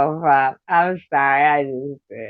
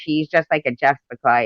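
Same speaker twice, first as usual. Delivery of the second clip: normal talking voice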